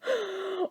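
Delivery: a small breathy gasp of joy; soft gasp. Retold or imitated. Gasping as the coworker shares the news. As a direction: gasp quietly in disbelief.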